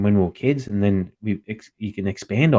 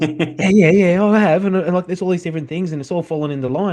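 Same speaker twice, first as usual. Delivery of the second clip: much higher and louder, keen rapid yeses; imitating an eager friend; 10 semitones higher, 6 dB louder than usual. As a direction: ham up a buddy insisting he's thought it through. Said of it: "And he goes".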